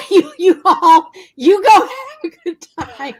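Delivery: laughing. Retold or imitated. Co-host joking she'd skip the walk, laughing. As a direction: wave them off while laughing. Joking that she would opt out.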